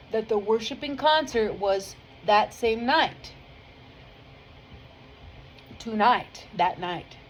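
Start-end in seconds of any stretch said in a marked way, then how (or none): none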